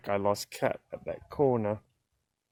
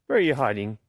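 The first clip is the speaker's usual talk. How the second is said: ordinary delivery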